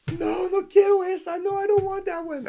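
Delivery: put-on high, small child's voice refusing; little boy's voice. Son refusing the big ride, high childlike plea. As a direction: pipe up like a frightened kid saying no.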